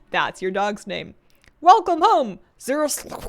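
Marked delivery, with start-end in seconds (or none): 1.6-3.1 excited welcome. Acted. Greeting the newly named pet.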